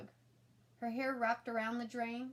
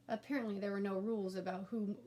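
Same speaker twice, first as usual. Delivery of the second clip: plain reading voice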